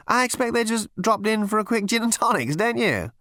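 high-pitched, breezy captain; airy captain. A narrator's reading. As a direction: suggest it lightly, up high. Captain airily dismisses the idea.

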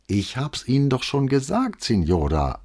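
unmoved. A narrator's reading. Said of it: "antwortete ... ungerührt".

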